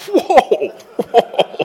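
laughing whoa, pitched high. Retold or imitated. Reading the shirt saying don't give up, laughing whoa. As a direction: break into astonished laughter. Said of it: "And I started laughing".

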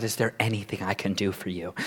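low, hushed offer of help; stoic, collected. Acted. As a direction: keep it soft and carefully composed. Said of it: "they'd be trying to be collected".

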